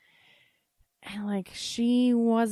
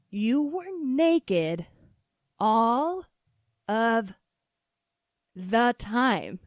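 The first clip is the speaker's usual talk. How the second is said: "you were naked all of the time" very slow, each word separated; emphatic insistence; 5 dB louder, much slower than usual. Retold or imitated. Child corrects mom, "all of the time" word by word, emphatic. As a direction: punch ALL, OF and THE TIME loudly, with pauses.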